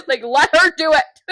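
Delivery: high, loud hammed-up insistence; exaggerated bossy holler; mock yell. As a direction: repeat it as a comic shout, laughing. Jokey exaggerated repeat of the quote.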